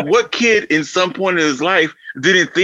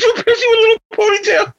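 watching the movie and spotting him, higher and faster; amused disbelief; faster than usual. Retold or imitated. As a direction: blurt it out in laughing disbelief. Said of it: "I was like".

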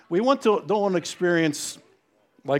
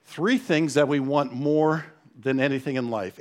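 usual speaking voice

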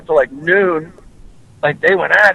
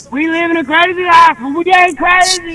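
quoted movie line; 12 semitones higher than usual. Retold or imitated. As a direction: belt the line like a film hero. Quoting a movie line with flair.

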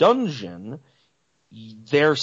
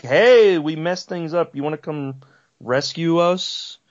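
mock-casual call for help, opening loud and high; casual plea; 5 semitones higher, 4 dB louder than usual. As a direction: lightly act out a breezy, higher-pitched request. Voices ancestors casually asking for help.

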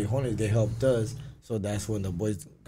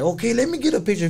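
high, loud gushing request; suddenly thrilled, beg for the photo; mock starstruck lady; excited fan; 12 semitones higher, 5 dB louder than usual.

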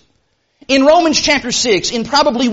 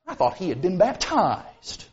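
low, quiet, condescending remark; 5 semitones lower, 9 dB quieter than usual. Retold or imitated. Grown-up remarking on a clowning newly baptized boy, condescending. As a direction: quote a disapproving grown-up under your breath. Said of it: "kind of a condescending tone".

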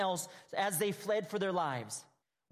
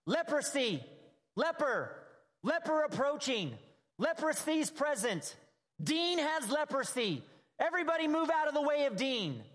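higher-pitched play-acted leper's cry; mock yelled warning; 6 semitones higher than usual. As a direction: call it out like a public warning, half joking. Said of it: "they would yell out".

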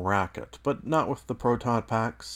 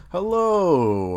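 louder fresh start; loud restart; 7 semitones higher, 7 dB louder than usual. Real, not acted. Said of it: "Oh, Jesus".

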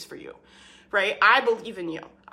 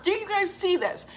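passionate sell; 8 semitones higher than usual. Retold or imitated. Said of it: "very passionately sell that offer".

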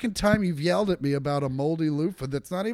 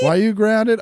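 mock parent voice; 3 semitones higher, 6 dB louder than usual. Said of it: "Good luck with that kid".

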